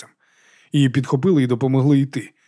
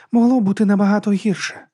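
put-on higher-pitched voice for a young heroine; lightly pitched-up character voice; character line; 8 semitones higher than usual. A narrator's reading. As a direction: lift the line up and away from narration. Tipsy heroine pipes up in narration.

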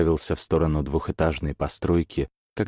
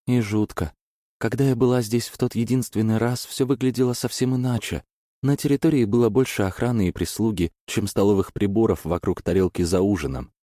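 quick, higher nervous chatter; rattling on nervously; 6 semitones higher than usual. Acted. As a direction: babble it out, anxious. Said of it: "тараторила она".

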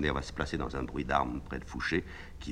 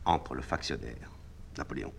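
normal talking voice

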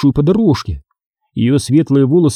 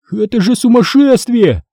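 high-pitched indignant cry; angry shout; 8 semitones higher than usual. A narrator's reading. Boy yelling at the radio voice, angry shout. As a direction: cry out angrily, pitched well up. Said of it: "сердито закричал я".

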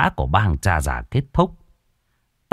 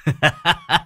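mild chuckle; 7 semitones higher than usual. Acted. Old man laughing mid-speech.

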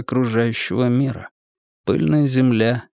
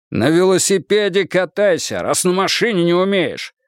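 stern, higher scolding; harsh barking commands; 6 semitones higher than usual. Acted. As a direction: scold her like a sergeant. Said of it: "Сурово начал было". (суровый командный окрик)

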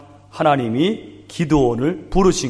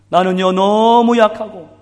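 pleading self-doubt; 5 semitones higher, 5 dB louder than usual. Retold or imitated. Voicing Gideon's self-doubt.

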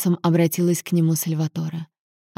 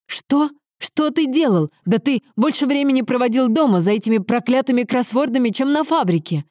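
high-pitched incredulous outburst; heated, higher-voiced indignation; indignant accusation; 6 semitones higher than usual. A narrator's reading. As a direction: cut in, accusing and scornful. Son cuts father off, accusing him.

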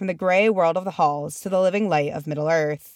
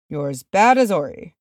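muttered; 4 dB louder than usual. A narrator's reading. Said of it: "muttered Nori".

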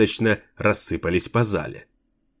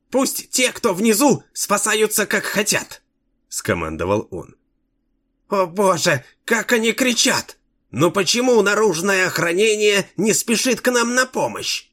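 very high, squealing frightened king; bleating in terror; 11 semitones higher than usual. A narrator's reading. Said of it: "Блея от ужаса".

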